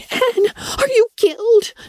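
very high, frantic cry of fear; shrieking girl. A narrator's reading. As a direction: shriek for a fallen friend. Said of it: "shrieked Anna".

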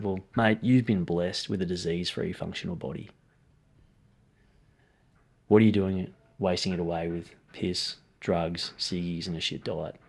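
low, hushed retold rebuke; grave and softly spoken, letting it sink in; sombre reproach. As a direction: quietly bring home a hard truth. Retells confronting client at cancer ward.

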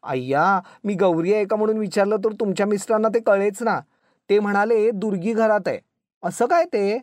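high, lively amused guest; lightly acted merry, high-pitched gossip. A narrator's reading. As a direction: exclaim with amused disbelief. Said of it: "ती पाहुणी हसून म्हणाली".

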